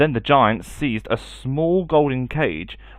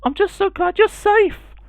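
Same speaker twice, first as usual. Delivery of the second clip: relieved mother; 4 dB louder than usual. Acted.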